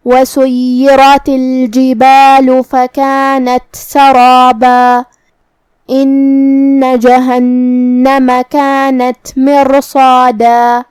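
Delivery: slow chanted tajweed recitation, stopping on the long alif of سَرَابًا; slow Quran recitation. Shown as a demonstration. Teacher demonstrates the verses for students, slow chant. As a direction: chant each word slowly, ending سَرَابًا on a stretched alif. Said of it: "اس پہ مد الف سے رکیں گے".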